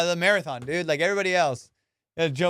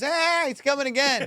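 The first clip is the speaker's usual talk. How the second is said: high mock cry of alarm; mock panic as he comes down; 10 semitones higher than usual. Onlooker sees the naked jumper return, high mock alarm. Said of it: "it goes".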